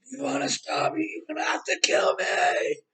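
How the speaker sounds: high-pitched, strained mock scream; hammed-up shrieking defiance; imitated scream. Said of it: "She, like, screams".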